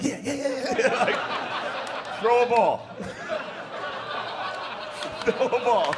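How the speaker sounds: high, breathless giggly excitement; overeager child. Retold or imitated. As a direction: bounce with excitement for the ball. Excited kid begging for ball.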